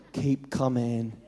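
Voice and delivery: soft, slow, low urging; God softly urging the swimmer on; gentle coaxing. Retold or imitated. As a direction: draw them forward gently. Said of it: "He's going".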